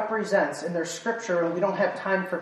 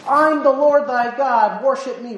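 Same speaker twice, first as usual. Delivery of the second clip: imagined self-proclaimed god; 5 semitones higher, 5 dB louder than usual. Pretend. Somebody interrupting church to claim he is God, mock proclamation. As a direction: announce yourself grandly to the whole room. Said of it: "somebody came up here and said".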